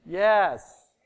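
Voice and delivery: a loud, high yes; triumphant loud yes; loud answer. Shown as a demonstration. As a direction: hit YES hard. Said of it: "the no means what?".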